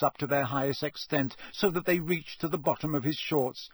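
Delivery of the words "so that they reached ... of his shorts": plain reading voice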